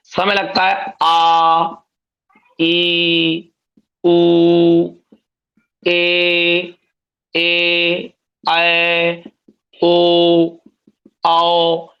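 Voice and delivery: each long vowel आ, ई, ऊ, ए, ऐ, ओ held slowly and stretched; drawn-out long vowels. Shown as a demonstration. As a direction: stretch every vowel so its double length is heard. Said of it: "इनको बोलने में दोगुना समय लगता है".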